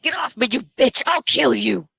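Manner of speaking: loud, fast, enraged threat; furious shout. Acted. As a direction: yell while fighting off an attacker. Acted fight, victim being stabbed.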